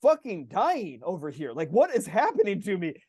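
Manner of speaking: high, loud frantic protest; desperate pleading. Retold or imitated. Panicking patient pleading with the doctor, high frantic protest. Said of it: "I'm like".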